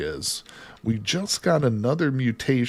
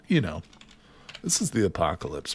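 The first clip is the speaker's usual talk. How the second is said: normal talking voice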